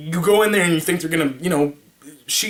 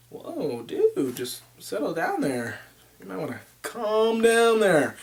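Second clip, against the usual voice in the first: soft, hushed settle down; quiet calming voice; mellow buddy; 5 dB quieter, slower than usual. Retold or imitated. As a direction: talk your friend down gently. Buddy telling him settle down.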